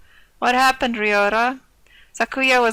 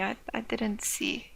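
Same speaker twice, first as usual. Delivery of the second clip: usual speaking voice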